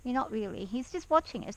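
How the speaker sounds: ordinary talk, nothing marked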